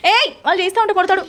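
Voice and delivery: sudden high scolding "hey"; sharp call-out. Real, not acted. Calling out to stop a disruptive child.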